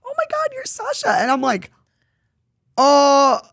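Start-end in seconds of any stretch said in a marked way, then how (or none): 0.0-1.1 put-on very high-pitched squeal of a young fan; excited little kid. Little kid spotting an idol in public, high and excited. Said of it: "a little kid came up to me and was like". 2.8-3.4 loud, high, drawn-out "oh"; mock-flustered cry, held long; stunned "oh". Caught off guard by a young fan, loud stunned "oh". Acts out own flustered reaction.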